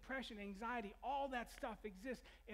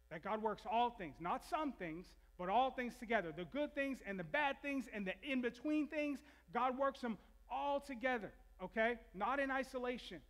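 louder and higher, driving each phrase; building preaching; 4 semitones higher, 5 dB louder than usual. Acted. Pastor urging his church, preaching at full force. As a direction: preach it home with rising fire.

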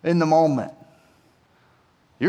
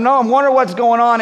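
normal talking voice

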